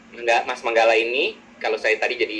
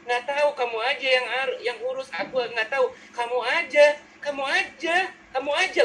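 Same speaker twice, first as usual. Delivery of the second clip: high-pitched dithering, acted; orang tak enakan; 8 semitones higher than usual. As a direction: keep handing the choice back in a high voice. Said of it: "Jadi yang S-nya rendah". (saling lempar keputusan dengan nada tinggi)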